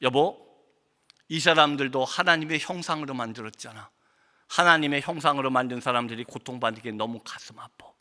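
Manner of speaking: retold tender plea, low and soft; quiet heartfelt reply. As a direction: voice a wife's gentle sorrow, sinking toward whisper. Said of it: "아내가 아무런 대답도 안 하고 딱 한마디만".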